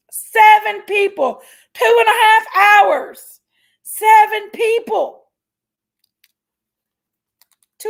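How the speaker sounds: loud, slow, high venting of the numbers; exasperated shout. Real, not acted. Caller furious over the phone runaround, shouting the tally. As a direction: spell out the insult of it, loud and slow. Venting about seven transfers.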